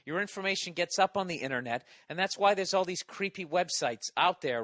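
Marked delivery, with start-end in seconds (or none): none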